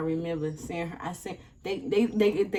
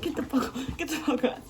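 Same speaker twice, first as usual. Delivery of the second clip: mock angry protest; 7 semitones higher than usual. Reenacting kicking at police.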